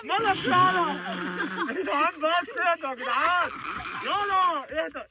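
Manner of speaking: high, loud scolding through laughter; laughing. Real, not acted. Host scolding giggling guest, laughing himself. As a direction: scold him but keep cracking up. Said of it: "انا بضحك انا".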